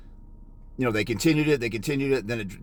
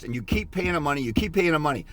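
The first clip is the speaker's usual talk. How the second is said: ordinary talk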